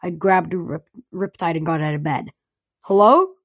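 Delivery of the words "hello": loud, high call into the dark. Acted. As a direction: call out warily. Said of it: "I called".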